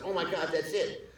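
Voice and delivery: high-pitched retold eureka; excited realization. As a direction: act out a sudden breakthrough.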